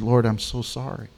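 soft, hushed, contrite; quiet sorry prayer; tearful apology. Retold or imitated. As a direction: whisper it near tears. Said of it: "with tears".

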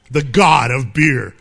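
low, slow commercial announcer; mock beer ad voice. Preacher imagining a beer ad, a slow low announcer voice. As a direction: sell it like a beer slogan. Beer companies come out with Bacchus.

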